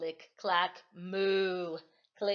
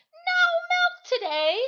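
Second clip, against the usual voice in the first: much higher and louder wail; anguished cry; 10 dB louder than usual. Acted. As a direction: cry it out in despair. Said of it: "cried Farmer Brown".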